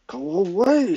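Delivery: high, resentful brush-off; muttered dismissal. A narrator's reading. Resentful page to dog as she passes, muttered dismissal. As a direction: shoo him off resentfully. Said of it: "she muttered as she walked by".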